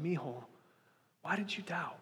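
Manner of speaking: put-on gentle parent asking a beloved child why he doubted; tender parent. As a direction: ask like a mother to her son. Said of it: "like I've seen a father or a mother say".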